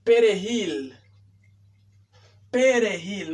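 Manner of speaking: "Perejil" with a tapped R; "Perejil" as pe-re-HIL; correct R. Shown as a demonstration. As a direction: say "Perejil" with R, not L. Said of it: "No es".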